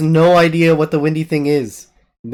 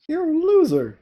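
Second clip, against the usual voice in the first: mocking taunt; 13 semitones higher than usual.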